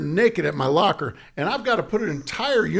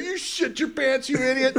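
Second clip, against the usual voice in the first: lightly voiced mocking catcher; 9 semitones higher than usual. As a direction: sneer it like the opposing catcher. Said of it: "they're looking at me like".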